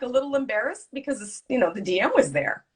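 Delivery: normal talking voice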